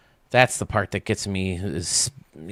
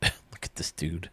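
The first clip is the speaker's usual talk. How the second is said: ordinary talk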